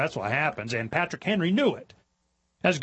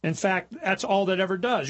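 ordinary talk, nothing marked

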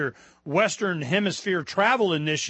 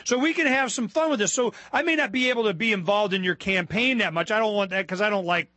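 ordinary talk

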